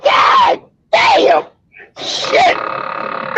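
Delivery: high strained burst fading to voiceless breath; acted freezing cold. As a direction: act out being shocked by biting cold. Acting out freezing hands and ears.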